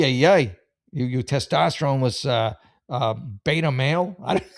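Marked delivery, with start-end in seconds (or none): none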